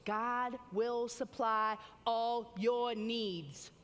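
very slow, each word weighed and driven home; slow emphatic proclamation. Acted. Preacher to the congregation, slow and emphatic. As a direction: lay the promise down word by word.

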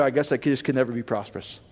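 mock-gloomy, low and soft; defeated sigh. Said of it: "and then we go".

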